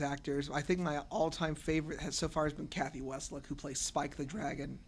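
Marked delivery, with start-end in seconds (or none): none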